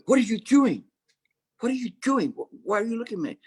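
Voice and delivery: high, sharp, flustered teacher caught napping; startled master. Acted. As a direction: pitch it way up, flustered and defensive. Startled master confronts staring monks.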